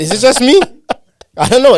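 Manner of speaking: high, loud playful protest; mock innocent teasing. Real, not acted. Ribbing his cohost about a pause, high and loud.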